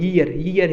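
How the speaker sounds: ईयर begun with a y glide, "yee-yar"; corrected pronunciation. Shown as a demonstration. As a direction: slowly say ईयर with y before the long ee. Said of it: "इसमें ये या है". (ईयर, शुरू में "य" की आवाज़ के साथ)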